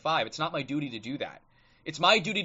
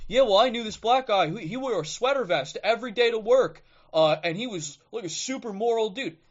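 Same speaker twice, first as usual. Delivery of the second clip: mock objector; 6 semitones higher, 4 dB louder than usual. Mocking an objector's anecdote.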